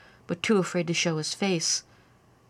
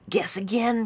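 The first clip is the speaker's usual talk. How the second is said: put-on youthful boy voice; 4 semitones higher, 3 dB louder than usual. A narrator's reading. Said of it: "came a youthful voice".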